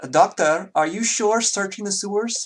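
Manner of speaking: put-on high, loud, accented feminine voice; a nervous assistant questioning the doctor; vaguely feminine high voice. Assistant asking the doctor in a high, loud voice. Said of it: "And it sounds kind of like this".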